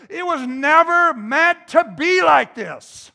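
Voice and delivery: angry outcry. Acted. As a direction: cry out in outrage, voicing divine anger. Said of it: "And so he's angry".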